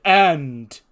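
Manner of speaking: loud, high, suspenseful single word; emphatic interruption. Acted. Boss cutting short the wrestler's thanks, emphatic.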